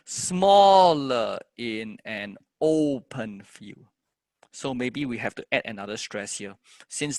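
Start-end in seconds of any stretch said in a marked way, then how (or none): 0.0-3.9 very slow, "smaller" and "open" drawn out; stressed verse line. Shown as a demonstration. Teacher testing the stresses in a line, slow recital. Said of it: "And the other one".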